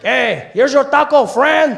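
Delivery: high, loud, slow vendor voice; taco guy. Retold or imitated. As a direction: hand it over like a cheery street vendor. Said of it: "comes back and he's like".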